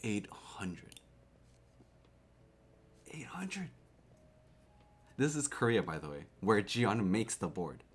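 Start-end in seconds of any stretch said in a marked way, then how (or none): 0.0-1.0 whisper. Real, not acted. Shocked at the price. 3.1-3.7 whisper. Real, not acted. Shocked at the price.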